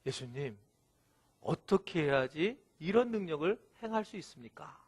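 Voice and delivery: low, hushed voice of a sheepish disciple; sneaky quiet question. Retold or imitated. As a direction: ask it very softly and low, on the sly. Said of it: "슬쩍 물어보는 거예요". (슬쩍 조용히 물어보는 제자 흉내)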